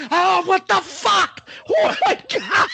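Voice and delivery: high-pitched, drawn-out exclamation of disgust; horrified outcry. Retold or imitated. Friend retelling the reaction to a stench, horrified outcry. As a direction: cry out high in mock horror.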